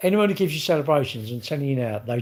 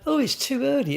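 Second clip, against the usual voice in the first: mimicking people with a much higher, whiny 'too early'; mocking high whine; 12 semitones higher than usual. Mocking people who arrive early with a high whiny voice. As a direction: pitch it way up like a fussy complainer.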